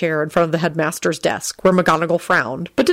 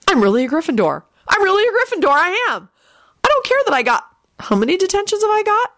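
put-on high, excited young boy voice; giddy schoolboy; 14 semitones higher than usual. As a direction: gush in disbelief, climbing higher.

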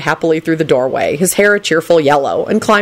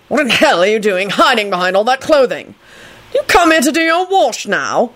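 raised-pitch indignant questioning; indignant shouting; 6 semitones higher than usual. A narrator's reading. Kingsley confronts Neville behind the clothing, indignant high-pitched questioning. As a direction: demand it with pitch climbing. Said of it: "he shouted".

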